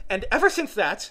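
high, loud lament; mournful. A narrator's reading. Hatter recounting his quarrel with time, mournful. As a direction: complain with a mournful air. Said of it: "went on in a mournful tone".

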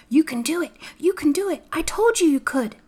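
put-on high, urgent girl's chant; muttered urging. A narrator's reading. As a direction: urge them on in a high voice. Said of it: "muttering something under her breath".

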